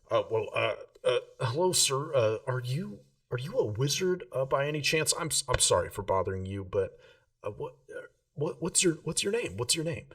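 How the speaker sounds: put-on a starstruck kid stammering to a wizard; nervous young Rill.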